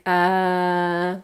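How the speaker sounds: long, low, soft "uh"; thinking filler. Shown as a demonstration. Teacher showing the vowel you use when you think, thinking filler. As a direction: draw out "uh" as if thinking. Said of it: "may use when you think".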